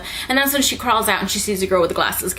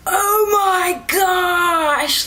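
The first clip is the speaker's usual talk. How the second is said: high, very drawn-out wail; horrified reaction; 9 semitones higher, much slower than usual. Retold or imitated. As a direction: stretch it into a mock-horrified cry. Retelling own horrified reaction.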